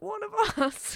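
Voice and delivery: excited squeal. Retold or imitated. Spotting another [name], squealing with excitement. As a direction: squeal it like you just spotted a fellow [name]. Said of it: "I get so excited 'cause I'm like".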